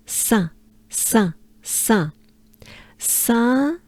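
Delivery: "Saint" said the French way, nasal vowel, silent t; French pronunciation demo. Shown as a demonstration. Teacher showing learners the saint's name, French pronunciation. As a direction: repeat "Saint" slowly as French "san", no final t. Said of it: "this is the sound".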